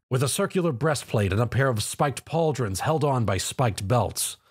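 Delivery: ordinary delivery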